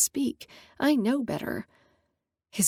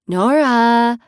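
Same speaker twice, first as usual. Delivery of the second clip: loud call of a name; 3 semitones higher, 8 dB louder than usual. Acted. Friend calling Nora out of the limo, loud call. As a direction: call her from outside the car.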